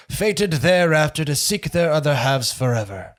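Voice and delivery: slow, high, hushed fairy-tale narration; old storytelling voice. Shown as a demonstration. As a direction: tell it like an old fireside tale. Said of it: "my old storytelling voice".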